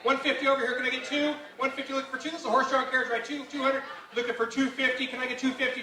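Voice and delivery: fast rising auction chant; auctioneer. Acted. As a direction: call bids like an auctioneer. Live auction calling.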